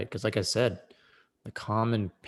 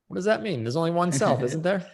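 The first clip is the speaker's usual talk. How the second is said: mock skeptic; 9 semitones higher, 4 dB louder, faster than usual. Host mocks people who doubt self-relationship, high mock puzzlement. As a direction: voice jumps much higher in a mock puzzled query.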